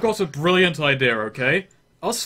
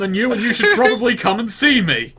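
loud, high proclamation to the enemy; boastful yell; 8 semitones higher, 6 dB louder, faster than usual. Acted. Player's character firing a pistol to draw out monsters, yelling. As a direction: yell it out with puffed-up self-importance. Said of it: "I stamp my feet, and I yell".

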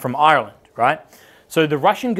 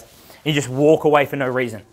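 normal talking voice

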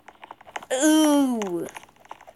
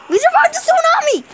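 excited; 14 semitones higher, 6 dB louder, faster than usual. Real, not acted. Survived the tsunami.

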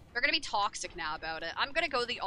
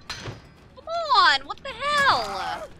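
much higher and louder, the hell drawn slow; exasperated yell; 8 dB louder, much slower than usual. Real, not acted. Streamer yelling at a survivor who keeps escaping, high exasperated protest. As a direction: protest loudly at the game.

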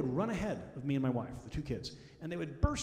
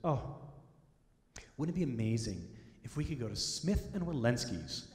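wistful; 3 semitones lower than usual. Retold or imitated. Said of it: "in this great wistful way".

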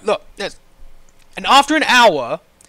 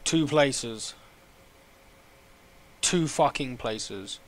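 low, quiet disbelief; dejected; 9 semitones lower, 12 dB quieter, slower than usual. Real, not acted. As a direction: say it softly, utterly deflated. Deflated at queue progress.

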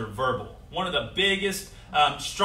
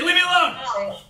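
real loud; 11 semitones higher, 7 dB louder than usual. Shown as a demonstration. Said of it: "be real loud for me".

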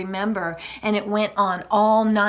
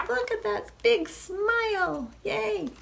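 delighted; 12 semitones higher than usual. Real, not acted. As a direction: coo and cheer in a high voice. Delighting with children at the picture.

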